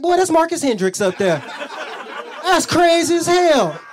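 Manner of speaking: loud, high exclamation of recognition; excited outburst. Retold or imitated. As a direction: blurt it out loud, drawing out the last words. Blurting recognition of buddy on TV.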